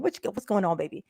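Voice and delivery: usual speaking voice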